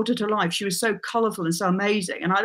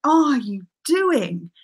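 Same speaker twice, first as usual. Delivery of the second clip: higher, slow, incredulous son's voice; imitating teenage son; 6 semitones higher, much slower than usual. Son walks in on mum reading aloud, mock incredulous. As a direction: draw it out like a baffled teenager. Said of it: "and he said".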